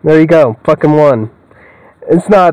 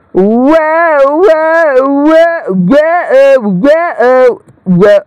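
much higher, stretched whoas fading down; high drawn-out whoa; 10 semitones higher, much slower than usual. Real, not acted. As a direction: gasp it out high and long.

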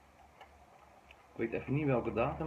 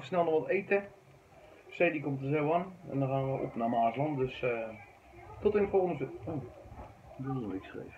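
ordinary talk, nothing marked

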